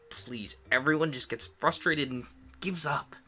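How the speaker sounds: put-on high pleading boy voice; begging Nick. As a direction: plead desperately with her.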